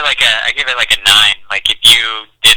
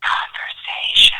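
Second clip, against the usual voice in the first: whispered echo of the title; spooky whispered echo of the show name; 4 dB quieter, slower than usual. Acted. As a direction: breathe the word like a stage whisper.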